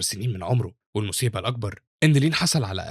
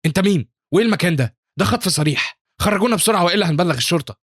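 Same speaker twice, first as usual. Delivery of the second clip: high, louder outraged protest; agitated; 7 semitones higher, 4 dB louder than usual. A narrator's reading. Crowd protesting a kidnapping to the stranger, agitated. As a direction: raise the pitch in alarmed indignation. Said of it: "في الانفعال وبيقولوا له".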